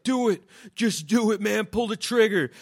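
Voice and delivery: the dog begging the hero to shoot; talking dog. Retold or imitated. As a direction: urge him on as the dog. Dog speaks in imagined scene.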